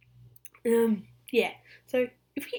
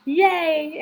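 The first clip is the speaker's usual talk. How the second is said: high, loud cheer; excited cheer; 8 semitones higher, 9 dB louder than usual. Real, not acted. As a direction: let out a loud, high hooray. Cheering that Kinkajou can count.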